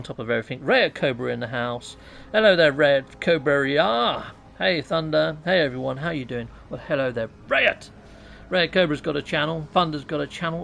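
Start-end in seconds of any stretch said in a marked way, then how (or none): none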